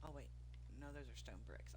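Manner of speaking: normal talking voice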